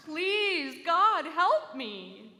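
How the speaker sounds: loud, high, drawn-out wail. A narrator's reading. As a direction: stretch every word into a loud desperate wail. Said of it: "he wailed again, louder".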